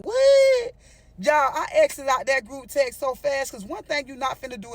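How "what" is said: high, loud shocked what; sudden shrieked what. Acted. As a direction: yelp it out in disbelief. Shocked at the group text.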